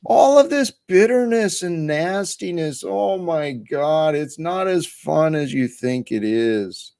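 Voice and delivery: high-pitched mock lecture to one's former self; talking to old self. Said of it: "Talking to my old self".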